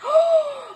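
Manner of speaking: high surprised intake of breath; a sudden high gasp; sharp gasp. Acted. Children reacting in story.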